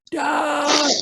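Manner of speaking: very high shout; shouting son. Acted. Son shouts to his father as the car swerves, very high. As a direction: scream for your father. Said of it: "Young male shouts".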